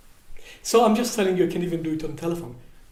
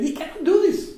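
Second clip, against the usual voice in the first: higher, louder voice of a despairing son; son's voice; 11 semitones higher, 6 dB louder than usual. Retold or imitated. Son on the phone says his timid self can't compete, high and loud. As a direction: play the son who insists, pitched up. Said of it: "I said".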